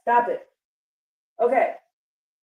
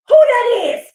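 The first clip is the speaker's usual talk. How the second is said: high, fired-up preaching with a few bursts of loud shouting; carried away by the reading, voice up high; real excitement, close to shouting; excited preaching; 5 semitones higher, 3 dB louder than usual. Real, not acted. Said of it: "I get excited".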